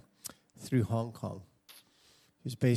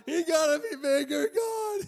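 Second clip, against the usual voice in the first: much higher, laughing and crying plea; 4 dB louder than usual. Retold or imitated. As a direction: beg God through a laugh. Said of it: "and I'd go".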